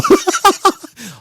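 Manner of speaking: high burst of disbelieving laughter. Real, not acted. Bursting out laughing at the plate story. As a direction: cackle in shock at the news.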